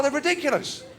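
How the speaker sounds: ordinary delivery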